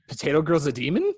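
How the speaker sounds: incredulous, jumping high on WHAT; mock shock. Retelling own reaction to demon scene, mock shock.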